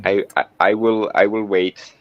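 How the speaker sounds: ordinary talk, nothing marked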